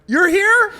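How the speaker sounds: high, loud shocked yelp; spotting him in the crowd, shocked. Retold or imitated. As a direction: squeal it in disbelief. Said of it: "I was like".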